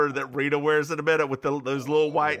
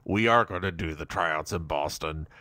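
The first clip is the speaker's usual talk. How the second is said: low Barry voice; 9 semitones lower than usual. Pretend.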